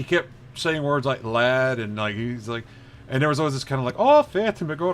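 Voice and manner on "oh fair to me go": high, loud mock Irish lilt; sing-song Irish brogue, played up. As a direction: imitate a lilting Irish accent. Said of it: "kinda, kinda like lilt to it".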